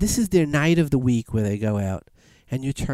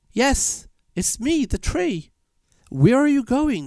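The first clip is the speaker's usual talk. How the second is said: high-pitched storybook tree voice; talking tree; 11 semitones higher, slower than usual. Retold or imitated. Tree reveals itself to the young man, high-voiced. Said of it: "the tree is talking to him".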